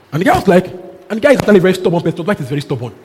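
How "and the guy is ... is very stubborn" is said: ordinary delivery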